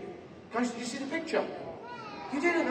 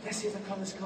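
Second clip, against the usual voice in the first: normal talking voice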